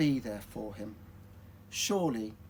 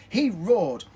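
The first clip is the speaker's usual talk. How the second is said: loud, higher storytelling punch; roared narration; 5 semitones higher, 10 dB louder than usual. A narrator's reading. Telling children the dragon roared flames, loud and dramatic. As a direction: hit it with a big storybook roar. Narrator voices the roar climax.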